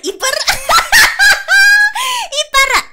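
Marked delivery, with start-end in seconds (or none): 0.5-2.3 high, loud burst of laughter; helpless high laugh; loud shrieking laugh. Real, not acted. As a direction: crack up loudly. Cracks up watching clip.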